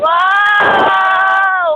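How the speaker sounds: amazed cry. Real, not acted. Amazed at the view.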